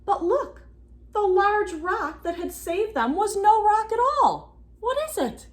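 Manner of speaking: high, loud amazed reveal; excited. A narrator's reading. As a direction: reveal it with wonder.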